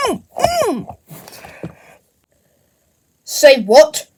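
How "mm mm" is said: high puppet hum. Acted. Puppet answers with hums.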